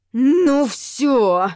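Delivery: end-of-patience outburst; hissing fury. A narrator's reading. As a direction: seethe like an angry cat. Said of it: "Я уже шипела не хуже кошки".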